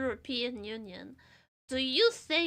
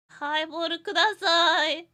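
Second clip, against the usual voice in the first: salaryman; 3 semitones higher, 12 dB louder than usual. Pretend. Salaryman ordering drink.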